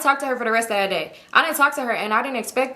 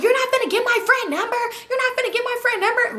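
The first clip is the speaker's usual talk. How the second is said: very high-pitched, insistent refusal; high singsong protest; mock protesting friend; 13 semitones higher than usual. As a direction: act out a friend objecting, pitched way up. Retelling friend refusing to share number.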